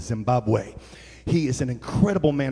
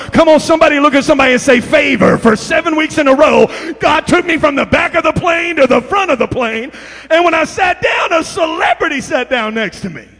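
high, loud preaching shout; full-force preaching; 13 semitones higher, 11 dB louder than usual. Real, not acted. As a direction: preach it to the back row.

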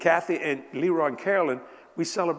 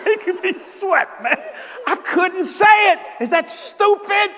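higher, loud laughing cry; laughing outburst; 14 semitones higher, 7 dB louder than usual. Real, not acted. Confessing to the congregation he could not say it, laughing shout. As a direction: burst out in embarrassed laughter. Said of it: "it makes me sweat right now".

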